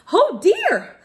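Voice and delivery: high, loud "Oh dear!" of alarm; sudden worried cry; cried out in dismay. Acted. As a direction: gasp it out like a startled little elf. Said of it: "he cried out".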